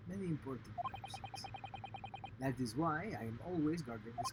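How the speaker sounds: proud Russian accent, lightly voiced; Russian sailor voice. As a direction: boast like a Russian guard.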